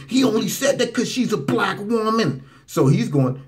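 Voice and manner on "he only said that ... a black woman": higher, hammed-up complaint; mock outraged media. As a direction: parody an indignant accusation. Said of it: "media afterwards is gonna say".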